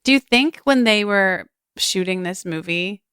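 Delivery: ordinary talk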